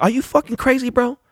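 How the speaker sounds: very high incredulous question; retold disbelief. Fan answering an angry player, incredulous.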